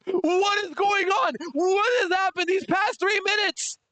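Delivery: high outraged cry, slow; high crying shout of outrage; rage voice. Real, not acted. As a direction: wail it high in disbelief. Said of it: "The same mocking rage voice".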